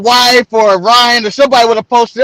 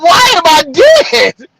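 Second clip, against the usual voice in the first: high, drawn-out mock bewilderment; incredulous; 6 semitones higher, slower than usual. Man replaying his answer to a tearful caller, slow high bewilderment. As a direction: stretch it out in hammed-up disbelief.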